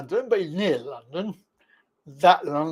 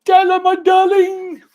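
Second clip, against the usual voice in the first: slow, loud, higher, drawn-out fond call; 12 semitones higher, 8 dB louder, slower than usual. Real, not acted. Greeting his friend's girlfriend Della as she comes in, with a slow, loud, affectionate call. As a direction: sing out her name like a grand affectionate greeting.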